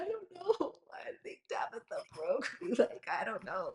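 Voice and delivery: giggly, high-pitched I don't know, laughing through it. Retold or imitated. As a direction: shrug it off between laughs. Laughing at her new emotions.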